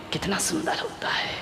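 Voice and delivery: wistful whisper. Acted. Swan wistfully describing the parrot.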